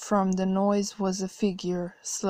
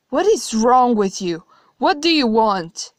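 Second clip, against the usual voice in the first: louder, higher, fed-up demand; exasperated outburst; 4 semitones higher, 9 dB louder than usual. Acted. Narrator losing patience with the cackling God, exasperated. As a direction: snap at him, out of patience.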